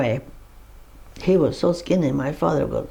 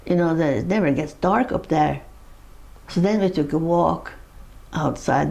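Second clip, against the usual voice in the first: ordinary delivery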